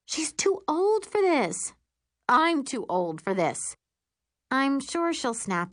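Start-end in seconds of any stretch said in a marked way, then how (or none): 0.1-1.7 put-on high, slow whine on "She's too old for this"; exasperated complaint. A narrator's reading. Stepmother complaining about her daughter, exasperated. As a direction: drag it out, pitched up and fed up.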